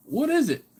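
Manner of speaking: mock puzzled. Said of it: "And he's like".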